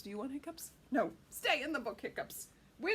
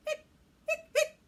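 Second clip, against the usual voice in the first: hiccup sounds; 12 dB louder than usual. Acted. Skeleton hiccuping.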